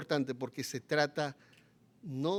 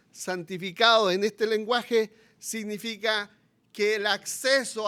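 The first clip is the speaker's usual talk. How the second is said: higher, louder impassioned preaching; fiery preaching; 6 semitones higher, 8 dB louder than usual. Acted. Preacher pleading with the congregation, fiery. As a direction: pound the point home to the congregation.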